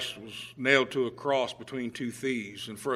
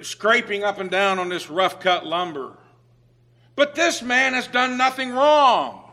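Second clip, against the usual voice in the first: impassioned thief; 5 semitones higher, 5 dB louder than usual. Retold or imitated. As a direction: preach the thief's confession full force, pitched high. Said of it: "He says".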